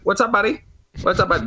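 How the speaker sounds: imitating a high-pitched, chirpy greeter; security guard's greeting. Retired cop security guard greeting whoever walks in, high-pitched mimicry. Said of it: "he's like".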